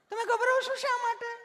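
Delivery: very high, sing-song nurse; sweetly asking nurse. Retold or imitated. Nurse asking why he is nervous, high mock sweetness. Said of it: "તમે કેટલું પ્રેમથી પૂછો છો".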